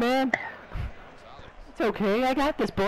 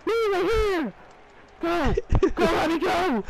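high, urgent calls for the ball; excited shouting; 8 semitones higher than usual. Pretend. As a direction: yell for the pass.

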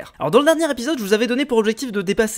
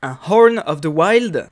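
Horn of the Wild drawn out slowly in a hammed-up English accent, louder; mock English accent; 3 semitones lower, 5 dB louder, much slower than usual. Shown as a demonstration. Presenting his new horn to viewers in a mock English accent. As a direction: show off the item name with an exaggerated English accent, slow and proud. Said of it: "avec mon super accent".

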